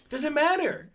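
insistent. Real, not acted. Urging him to get tested.

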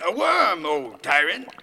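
loud theatrical outburst; hammed-up, emphatic homage; servile exclamation. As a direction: cry out in grand deference to a tyrant. Servant exclaims to tyrant.

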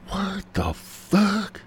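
high, very slow what the fuck; stretched shocked disbelief. Retold or imitated. Reading the messages to her, with high, slow shock. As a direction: stretch it out, floored.

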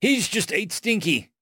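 high, alarmed exclamation; mild high-pitched shout of dismay. A narrator's reading. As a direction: exclaim in disbelief with pitch jumped up. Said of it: "shouted Bugrat".

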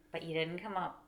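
ordinary delivery

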